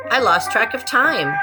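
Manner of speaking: plain reading voice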